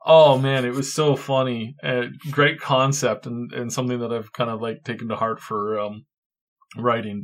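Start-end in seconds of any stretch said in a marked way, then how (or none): none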